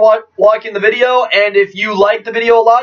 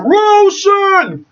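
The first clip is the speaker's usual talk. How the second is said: high excited exclaim; 12 semitones higher than usual. Real, not acted. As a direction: pop up high and slow.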